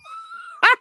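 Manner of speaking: shrill giggle way up high; real high-pitched laugh; high squealing laugh. Real, not acted.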